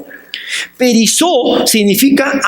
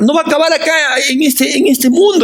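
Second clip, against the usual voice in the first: much higher, fast and building; rising fervor; 9 semitones higher, faster than usual. Real, not acted. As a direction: preach it with rising fire. Preacher builds to climax. (fervor de predicador que sube)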